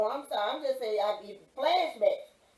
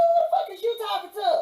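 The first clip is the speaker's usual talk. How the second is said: higher and louder furious comeback; retold furious shout; 11 semitones higher, 7 dB louder than usual. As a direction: yell it back at the guard, outraged. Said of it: "I said".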